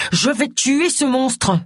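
very slow, high-pitched threat; angry grumbling. A narrator's reading. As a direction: drag out each word in anger. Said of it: "grommela Agatha". (menace rageuse, lente et aiguë)

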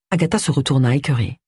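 ordinary delivery, nothing marked

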